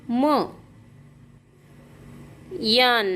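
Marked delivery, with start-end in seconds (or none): 0.1-0.4 म as an isolated "muh"; phonic M sound. Shown as a demonstration. Teacher showing the sound of M, म as "muh". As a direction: sound out म, not the letter name. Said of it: "यमचा उच्चार होतो".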